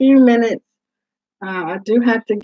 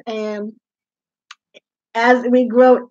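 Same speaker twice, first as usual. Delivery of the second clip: ordinary delivery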